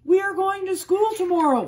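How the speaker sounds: high, loud excited announcement; excited farmer announcing. A narrator's reading. Farmer telling the cows, high and loud with excitement. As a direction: call it out high and bright with excitement. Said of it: "Farmer Brown told the chickens".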